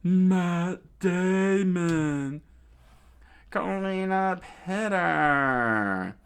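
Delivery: slow, high, loud call; villain screaming the finishing move; screamed attack call. Acted. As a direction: bellow the move name. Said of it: "she screamed out".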